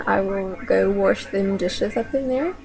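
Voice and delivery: lightly drawled Southern "wash them dishes"; thick Southern accent. Shown as a demonstration. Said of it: "A thick Southern version of that would be".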